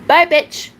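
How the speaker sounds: real scornful shout; loud dismissal. Real, not acted. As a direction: shout the troll out the door. Dismissing the troll.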